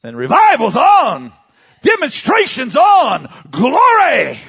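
high, loud revival shout; exultant preacher's yell; full-force preaching. Real, not acted. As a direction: fire up the congregation at full voice.

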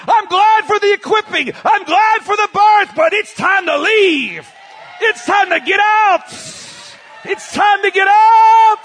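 very high, loud sustained shouting; exultant pulpit yell; full-force preaching. Real, not acted. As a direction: declare departure like a revival preacher on fire.

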